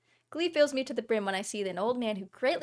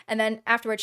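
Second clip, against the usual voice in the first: usual speaking voice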